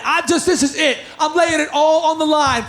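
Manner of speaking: ordinary talk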